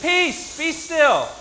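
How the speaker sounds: loud, high command over the storm; commanding shout. Acted. Preacher as Jesus stilling the noisy storm, commanding shout. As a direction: cry out to silence the noise.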